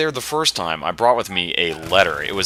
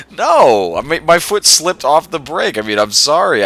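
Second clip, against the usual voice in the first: re-enacted defensive protest, high and loud; flustered protest; 6 semitones higher, 6 dB louder than usual. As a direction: plead your innocence, louder and higher. Re-enacts defending herself to the driver.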